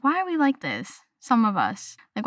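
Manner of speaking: ordinary talk, nothing marked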